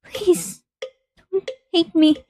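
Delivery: high, pleading voice close to tears; desperate pleading. Acted. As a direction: beg Kazuki not to hate you. Pleading with dead Kazuki.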